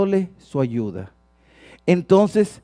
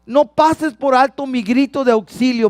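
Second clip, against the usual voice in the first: high, loud cried-out prayer; raised pleading voice; desperate plea; 7 semitones higher, 5 dB louder than usual. Acted. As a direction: keep crying it out urgently to God. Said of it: "hay esa desesperación".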